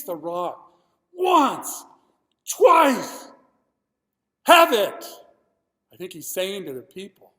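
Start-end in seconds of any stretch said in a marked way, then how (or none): 1.1-5.0 much higher, much louder furious shouts; angry shout. Acted. Moses strikes the rock twice, shouting in fury. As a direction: slam out each word like a blow.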